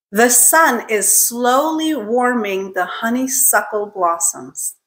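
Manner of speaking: slow, careful pronunciation demo; model sentence. Shown as a demonstration. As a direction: say it slowly for a learner to repeat, each S clear. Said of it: "say the sentence one time".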